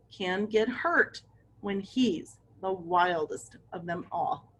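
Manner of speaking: ordinary delivery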